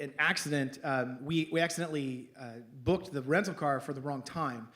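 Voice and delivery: ordinary talk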